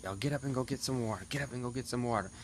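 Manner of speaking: imitating the sergeant. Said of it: "Sergeant Guillory ended up coming up and was like".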